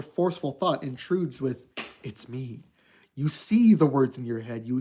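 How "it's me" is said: quiet eerie whisper. Acted. Golden Freddy's voice in head.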